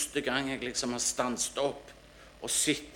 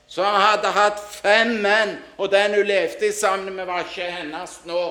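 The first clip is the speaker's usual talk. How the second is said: impassioned preaching; 7 semitones higher, 9 dB louder than usual. Acted. Preacher urging friends to open their hearts, impassioned preaching. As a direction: drive each phrase home to the congregation.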